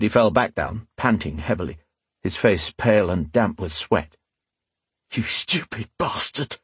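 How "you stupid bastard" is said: soft, higher, strained curse; hissed through clenched teeth. A narrator's reading. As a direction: hiss the insult through pain. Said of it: "he hissed through clenched teeth".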